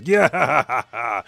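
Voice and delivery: loud, gloating mock cackle; imitated villain laughter. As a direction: burst into a put-on villain's laugh. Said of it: "And Samar Singh".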